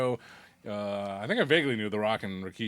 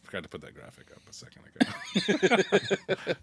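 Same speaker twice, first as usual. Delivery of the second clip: whisper; 4 semitones higher than usual. Real, not acted. Aside whisper about graphic.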